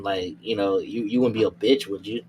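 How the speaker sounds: ordinary talk, nothing marked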